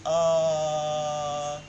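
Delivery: "ah" stretched out on one steady breath; sustained vowel. Shown as a demonstration. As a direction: keep "ah" going without stopping the air. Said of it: "You can simply go on saying". (long held vowel demo)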